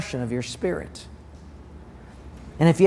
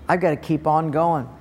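normal talking voice

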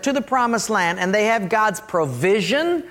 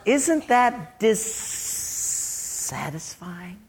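slow, insinuating devil, softly stretching the words; tempting devil; 4 dB quieter, slower than usual. Acted. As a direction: coax like a sly tempter, drawing out key words. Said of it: "And the devil says".

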